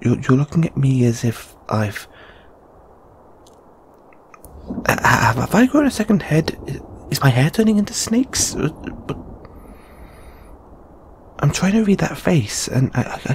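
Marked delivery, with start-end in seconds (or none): 4.8-8.5 high, rapid stammering questions; flustered bafflement. Acted. Unsettled by companion's strange face, flustered and baffled. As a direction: stumble in, pitch climbing with each question.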